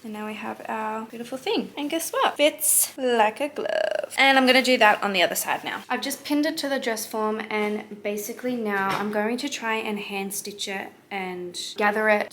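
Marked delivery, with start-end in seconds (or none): none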